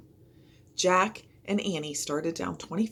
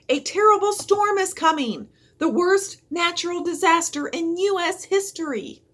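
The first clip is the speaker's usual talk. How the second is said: higher, louder urgent warning; yelled warning; 12 semitones higher, 7 dB louder than usual. A narrator's reading. Jack warning the crowd of the disaster, a loud yell. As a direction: warn them with all your breath. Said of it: "he yelled".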